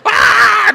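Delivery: high, loud outraged yell; mock furious scream. Husband reacts to his wife's monkey request, outraged scream. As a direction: scream in hammed-up exasperation. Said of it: "that's what he".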